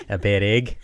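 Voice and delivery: a light go at a Kiwi accent; New Zealand accent. Shown as a demonstration. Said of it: "You do it".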